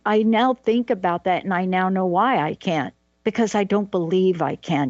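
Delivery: usual speaking voice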